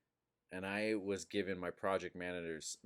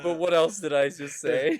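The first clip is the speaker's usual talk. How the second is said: louder and higher, desperate; laughing loud question; 7 semitones higher, 13 dB louder than usual. Retold or imitated. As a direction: beg for the answer, laughing. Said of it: "he's like".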